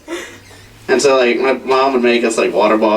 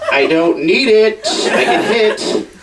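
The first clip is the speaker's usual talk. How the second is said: put-on stubborn kid; 9 semitones higher, much slower than usual. Kid defending his soda.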